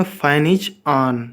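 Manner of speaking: each word drawn out very slowly; teacher spacing out Fan is on; slow model sentence. Shown as a demonstration. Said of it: "क्या बोलेंगे".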